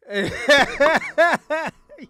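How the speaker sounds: loud, high burst of laughter; big laugh. Real, not acted. Cracking up at own [name] joke, loud laughter.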